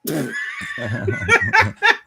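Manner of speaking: sudden high-pitched burst of loud laughter; high shrieking laugh. Real, not acted. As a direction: cackle out loud in delight. Friend finds remark hilarious.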